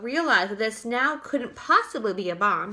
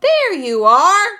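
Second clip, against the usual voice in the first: slow, loud, higher triumphant cry; 7 semitones higher, 12 dB louder, slower than usual. A narrator's reading. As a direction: cry it in vindication. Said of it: "cried the chief of police".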